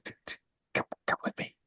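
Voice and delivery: hushed, stammered whisper; nervous whisper. Acted. Pious woman furtively ushers the player away, nervous whisper. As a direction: whisper it furtively, tripping on the first word. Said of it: "Why are we whispering?".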